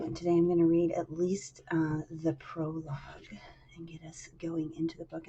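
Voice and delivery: soft unvoiced whisper. Real, not acted. Whispering to the stream audience about the plan. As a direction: drop to a whisper mid-sentence. Whispered aside while introducing reading.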